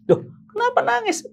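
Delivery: high-pitched, puzzled "why are you crying"; bewildered retold question. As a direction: act out baffled concern, pitched high. Menirukan dirinya membujuk calon menangis. (menirukan tanya bingung bernada tinggi)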